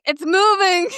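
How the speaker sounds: high-pitched startled exclamation; mock alarm. Telling how the dead possum moved, mock alarm. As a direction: act out a sudden shock, voice jumping up. Acting out shock at possum moving.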